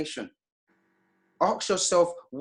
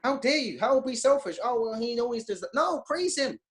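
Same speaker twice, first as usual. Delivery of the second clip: indignant; 7 semitones higher than usual. Real, not acted. Indignant rhetorical outburst.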